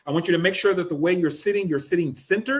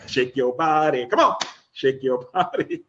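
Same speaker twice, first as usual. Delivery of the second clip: playful coaxing; 5 semitones lower than usual. Shown as a demonstration. Playfully urging audience to shake.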